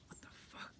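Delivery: very soft, breath-only disbelief; stunned whisper. Retold or imitated. Reacting to the being's terror and anger, stunned whisper. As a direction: whisper it, barely audible.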